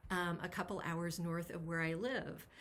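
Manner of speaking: ordinary delivery, nothing marked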